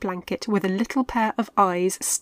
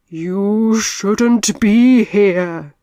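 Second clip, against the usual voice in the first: very slow, much louder ghostly moan; spooky ghost voice; 11 dB louder, much slower than usual. A narrator's reading. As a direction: drag it out like a ghost. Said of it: "the voice spookily spoke".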